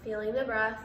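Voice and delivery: measured meditation voice with long pauses; guided meditation. Real, not acted. Meditation host guiding listeners through breath and body awareness, calm and spacious. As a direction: guide listeners into stillness, unhurried, pausing between phrases.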